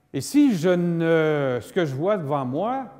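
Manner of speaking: ordinary talk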